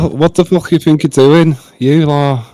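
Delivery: high, energetic Scouse imitation; high-pitched Scouse accent demo. Shown as a demonstration. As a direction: put on a lively Scouser. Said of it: "Can you replicate it?".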